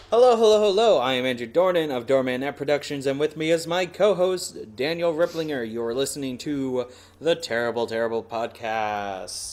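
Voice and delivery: big booming podcast opening; hammed-up loud show intro; loud announcer intro. Shown as a demonstration. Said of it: "let's try that again".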